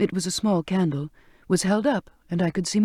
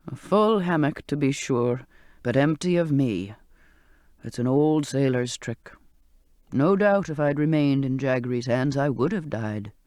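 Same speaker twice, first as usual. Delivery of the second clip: put-on old sailor's voice; 4 semitones lower than usual. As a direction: coax her gently to touch him. Old man explains trick.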